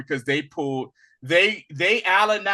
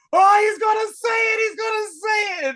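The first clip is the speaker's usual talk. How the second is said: excited squeal; 4 dB louder than usual. Retold or imitated. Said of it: "he was like".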